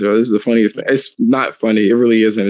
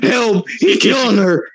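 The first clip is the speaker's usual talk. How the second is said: high squealing imitation of a frantic woman; mock panicked scream; 10 semitones higher, slower than usual. Retelling a chimp attack video, mock high panicked cry. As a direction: mimic a panicked cry for help, hammed up. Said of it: "and she was like".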